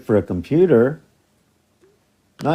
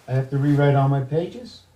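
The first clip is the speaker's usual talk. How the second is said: ordinary talk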